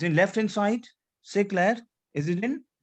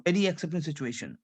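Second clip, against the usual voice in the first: ordinary talk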